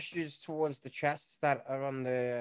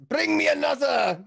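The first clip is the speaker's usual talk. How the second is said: boisterous shout; 13 semitones higher, 11 dB louder than usual. Acted. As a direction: bellow it out, much louder and higher. NPC shouts for another drink.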